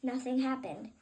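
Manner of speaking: plain reading voice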